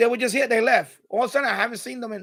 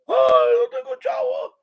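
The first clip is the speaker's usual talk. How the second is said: high mock wail in Spanish; mother wailing over the rent, high and mocking; 3 dB louder than usual. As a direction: squeal it like the broke mother. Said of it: "imitating the crying mother".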